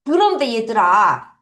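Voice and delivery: usual speaking voice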